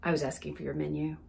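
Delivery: low, quick, quiet putdown; looking down. Retold or imitated. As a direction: imitate a mildly disdainful waiter. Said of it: "looks down her nose at her".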